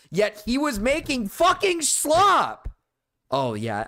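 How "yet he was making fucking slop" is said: high, loud, drawn-out outburst; pissed off. Real, not acted. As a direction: shout it slowly in exasperation, pitch soaring. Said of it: "I'm getting pissed".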